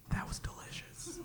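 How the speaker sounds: barely audible, savored whisper; sly whisper. Retold or imitated. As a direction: whisper it like a private gloat. Mimics son finishing watermelon prank.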